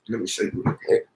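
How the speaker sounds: fast, whispered aside. Real, not acted. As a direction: mutter it off to the side. Aside while fetching pot.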